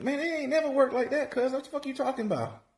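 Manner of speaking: can't believe what he just heard; exasperated disbelief. Retold or imitated. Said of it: "I'm like".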